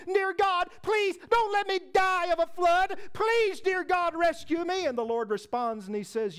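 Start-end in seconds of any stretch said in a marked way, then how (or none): 0.1-4.9 high, urgent mock pleading; acted-out panicked plea to heaven; desperate prayer. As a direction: beg God to save you, pitched up. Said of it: "he begins to pray again".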